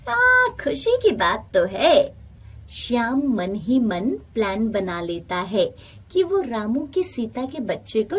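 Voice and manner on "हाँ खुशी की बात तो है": higher and louder forced agreement; envious friend faking gladness; grudging envy. Acted. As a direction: hide the jealousy behind a strained yes. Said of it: "उसे ये अच्छा नहीं लगता था".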